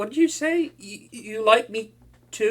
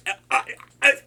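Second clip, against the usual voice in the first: ordinary delivery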